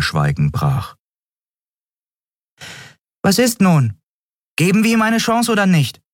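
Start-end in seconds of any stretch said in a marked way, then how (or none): 3.2-6.0 put-on high, impatient young voice; Nelen's voice. A narrator's reading. Nelen pushes the crew to decide, high and impatient. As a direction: break the silence, pushy and high.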